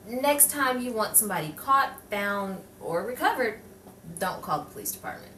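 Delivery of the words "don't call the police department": fast, hushed whisper tossed off mid-sentence; quick whispered aside. Acted. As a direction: slip it in like a secret tip, quickly. Reporter's aside in sign-off.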